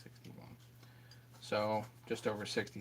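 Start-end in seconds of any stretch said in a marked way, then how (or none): 0.0-0.6 muttering quietly. Real, not acted. Muttering measurements to himself.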